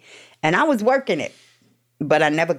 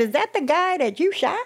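a much higher voice for the woman, imitating her; high incredulous imitation of the older woman; mock scold; 14 semitones higher than usual. Retelling the woman who scolds her for visiting her ex.

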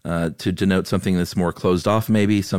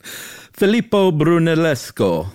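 high, slow, hammed-up Italian accent; showy Italian accent; 10 semitones higher, 4 dB louder, slower than usual. Host takes over the Italian name for co-host, showy Italian accent. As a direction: roll out the name in theatrical Italian.

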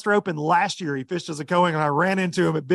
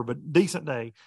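normal talking voice

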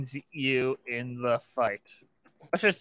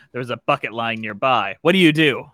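ordinary talk, nothing marked

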